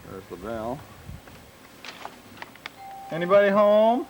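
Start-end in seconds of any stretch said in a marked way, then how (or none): 3.1-4.1 loud call into the house; calling down a hallway. Real, not acted. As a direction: call out, higher and louder.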